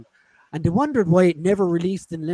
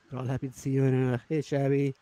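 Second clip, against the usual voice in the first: usual speaking voice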